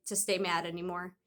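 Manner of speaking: ordinary delivery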